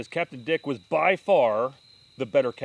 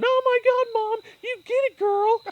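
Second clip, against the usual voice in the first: put-on much higher, squealing teen girl; imitating Brittany squealing; 6 dB louder, slower than usual. Brother mimics sister cheering mom's catch, a high squeal. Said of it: "you hear Brittany going".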